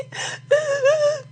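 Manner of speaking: very high fake sobs; hammed-up tearful squealing; mock sobbing. Host finishes imitating Kim Kardashian's cry, mock sobbing. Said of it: "Kim's gonna go cry".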